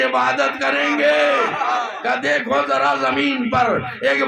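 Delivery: high and loud, declaiming to the crowd; impassioned majlis oratory; preaching at full force. Acted. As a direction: thunder the sermon's climax. Zakir preaching the climax at full force.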